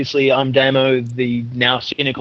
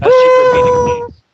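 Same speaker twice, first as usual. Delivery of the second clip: sudden squeal, much higher and louder; squeaky high voice; 6 dB louder than usual. Pretend. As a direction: squeak it out like a cartoon. Mock secret password squeal.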